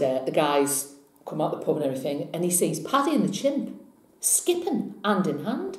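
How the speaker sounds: ordinary delivery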